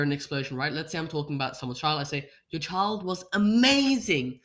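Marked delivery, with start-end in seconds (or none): 2.5-4.4 louder, slow, cheering exclaim; cheering praise. Shown as a demonstration. Speaker demonstrating praise for a parent, loud and cheering. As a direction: cheer the child on with big excitement. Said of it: "I bring my hands up in the air as if I'm cheering them on".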